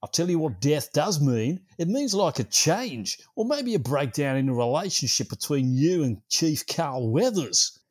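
loud, high, hammed-up Australian accent. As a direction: belt it out as a mock Australian know-all. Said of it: "All in an Australian accent".

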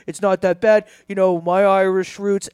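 lightly voiced politicians scheming; a hint of a slick politician covering up; retelling the Kennedys' meeting, lightly mocking. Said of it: "they were all like".

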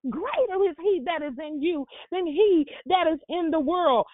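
driving, emphatic sermon delivery; fervent preaching. Real, not acted. Preacher urges the congregation to believe God is in them, fervent preaching. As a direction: preach it in punchy, repeated phrases.

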